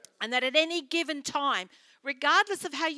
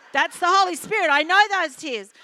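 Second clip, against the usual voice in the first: loud, raised-pitch insistence; retold emphatic declaration; 6 semitones higher, 9 dB louder than usual. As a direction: proclaim it boldly, higher and much louder. Retold emphatic reply to crying woman.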